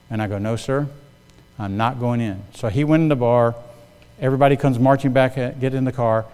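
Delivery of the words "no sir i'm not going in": low and very soft, holding firm; quiet, respectful refusal. Retold or imitated. Manager declining the boss's order to enter, quietly respectful. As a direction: refuse the boss meekly but firmly.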